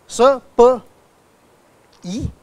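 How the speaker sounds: "s, p, e" as separate sounds with pauses; sounding out. Shown as a demonstration. Lecturer splitting a word for students, sounding out. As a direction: break "speak" into s, p, e, one by one. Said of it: "We speak in syllables".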